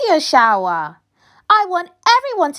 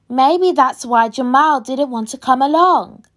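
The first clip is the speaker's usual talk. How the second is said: ordinary delivery, nothing marked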